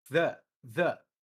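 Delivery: The with a TH breath and short e; tongue out, puff, e; th sound. Shown as a demonstration. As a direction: repeat the TH demo. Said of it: "Todo mundo conseguiu fazer".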